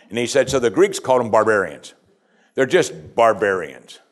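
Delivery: ordinary talk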